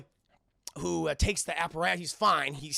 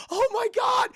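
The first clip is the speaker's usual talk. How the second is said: very high, loud mock scream; panicked shriek; 9 dB louder, slower than usual. Blade sees the robot coming, shrieking in panic. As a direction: shriek it as a terrified victim.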